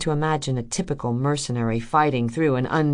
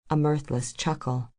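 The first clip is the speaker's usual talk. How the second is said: ordinary delivery, nothing marked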